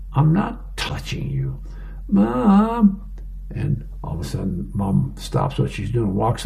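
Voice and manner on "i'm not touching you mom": put-on high, slow child's protest; whining kid. Kid in another room calling to Mom, high mock whine. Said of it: "from one of the kids in another room".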